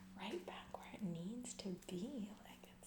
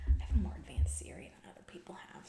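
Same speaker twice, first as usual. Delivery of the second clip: ordinary talk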